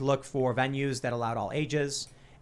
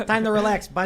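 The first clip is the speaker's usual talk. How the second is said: put-on loud, higher Chicago accent; 7 semitones higher, 7 dB louder than usual. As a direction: call it out like a Chicago local. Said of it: "please do your Chicago accents".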